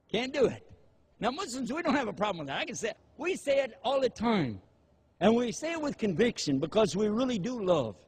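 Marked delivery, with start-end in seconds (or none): none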